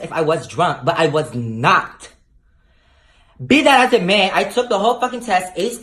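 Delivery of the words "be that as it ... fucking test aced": triumphant declaration. Real, not acted. Triumphant pivot in rant.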